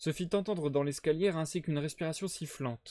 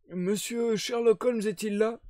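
put-on slow, higher old sailor voice; wheezy old man; 8 semitones higher, 3 dB louder than usual. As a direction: play a tired old seaman. Old sailor first speaks.

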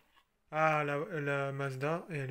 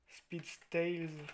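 English accent; 2 semitones higher, much slower than usual. Shown as a demonstration. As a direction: Speed Style in a slow, put-on English accent. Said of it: "avec l'accent anglais".

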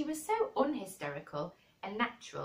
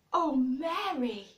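higher, louder, slow half-sobbed cry; 8 semitones higher, 8 dB louder, slower than usual. Acted. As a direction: cry out on the edge of tears. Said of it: "he cried out with a half sob".

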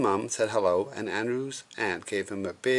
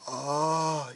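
higher drawn-out cry; mild mock yell; 6 semitones higher than usual. A narrator's reading. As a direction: cry out in pretend horror. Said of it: "yelled Andrew".

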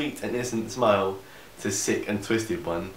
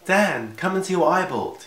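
excited call; 9 semitones higher, 4 dB louder than usual. Acted. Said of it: "shouted to Dan".

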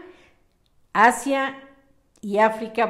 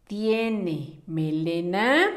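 very slow and lower, rising at the end; slow dictation; 4 semitones lower, much slower than usual. Shown as a demonstration. Teacher repeats the words for pupils writing, slow dictation. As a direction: space the words out for copying.

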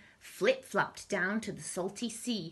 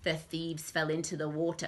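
plain reading voice

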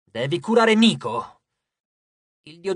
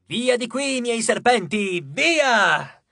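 shout to the sky; 5 semitones higher, 4 dB louder, much slower than usual. A narrator's reading. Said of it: "gridava al cielo".

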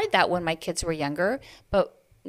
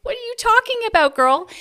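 much higher, louder sassy disbelief; incredulous retort; 9 dB louder than usual. Retold or imitated. Mother stunned by the other mom, much higher and louder. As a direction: toss it back with a sassy squeal.

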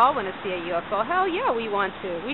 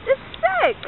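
excited. Real, not acted. Reacts to moving light.

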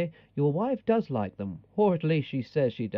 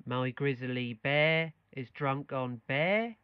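final bear still said like bear, quieter; quiet last try, still Norfolk; norfolk accent; 3 semitones lower, 6 dB quieter, slower than usual. Shown as a demonstration. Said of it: "Really, really try this time".

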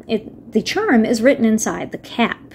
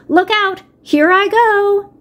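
higher, drawn-out wail as she melts away; melting witch cry; 10 semitones higher, 3 dB louder, slower than usual. Acted. Melting witch to Dorothy, a high slow wail.

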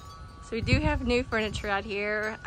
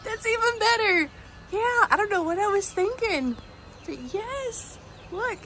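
thrilled gushing; 9 semitones higher than usual. Real, not acted. Thrilled the decoration lights up.

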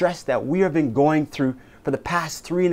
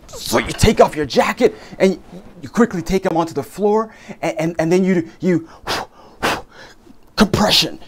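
frantic CPR; 4 semitones higher, 5 dB louder than usual. Acted. Acted CPR emergency scene.

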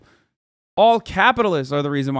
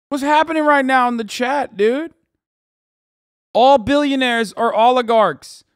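loud, high-pitched protest, hammering each point; exasperated; 6 semitones higher, 5 dB louder than usual. Real, not acted. Streamer baffled by what is happening in chat, exasperated. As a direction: argue back in raised, incredulous disbelief.

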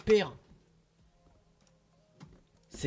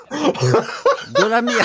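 laughing; 4 semitones higher, 3 dB louder, much slower than usual. Real, not acted. Laughing disgust.

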